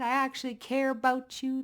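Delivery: high, lightly voiced fake caring; mock sincerity. Reader mocking manipulators' fake caring in a high voice. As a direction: mimic a fake friend.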